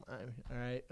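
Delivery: low, very soft answer; sheepish reply. Retold or imitated. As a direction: give in meekly to the doorman. Retold meek answer to doorman.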